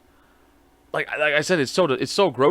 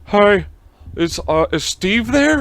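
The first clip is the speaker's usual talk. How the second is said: imitating caller; 7 semitones higher, 5 dB louder, slower than usual. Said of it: "I'm like".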